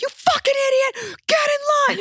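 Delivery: imitating the guard screaming at her in line; screeching guard. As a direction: shriek it like a furious guard. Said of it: "it's just like".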